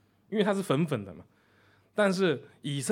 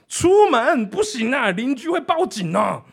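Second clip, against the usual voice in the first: shocked; 9 semitones higher, 10 dB louder, slower than usual. Retold or imitated. Operator shocked.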